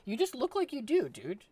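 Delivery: high, loud quoted line; blurted out high and loud; quoting someone. Retold or imitated. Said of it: "when I told".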